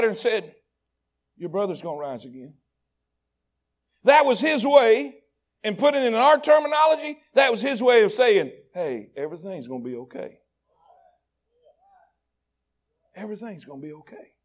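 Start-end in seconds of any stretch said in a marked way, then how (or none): 1.4-2.6 gentle promise. Acted. Jesus gently comforting Martha. 8.7-14.3 lower, much softer, hushed comfort; tender reassurance. Acted. Jesus comforting grieving Martha, soft, low and tender.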